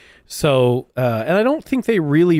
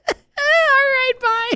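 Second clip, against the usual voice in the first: laughing. Real, not acted. Laughing goodbye after joke.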